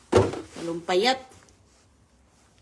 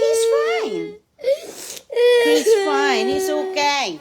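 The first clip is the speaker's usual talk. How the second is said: high, louder tearful reassurance; crying, reassuring; 11 semitones higher, 6 dB louder, slower than usual. Real, not acted. Mother soothing crying child, high and teary. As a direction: soothe through tears.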